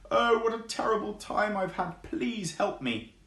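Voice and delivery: high, pleading, slowing at the end; frightened tortoise. Acted. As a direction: beg for help, scared.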